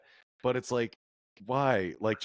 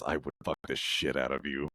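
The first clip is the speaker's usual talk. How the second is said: low lusty voice; 8 semitones lower than usual. Retold or imitated. Godzilla lust, low.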